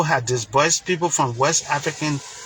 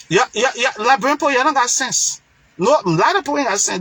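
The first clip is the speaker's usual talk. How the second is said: exasperated scolding; 6 semitones higher, faster than usual. Real, not acted. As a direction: berate your own people, pitch up in frustration. Scolding fellow Liberians on air.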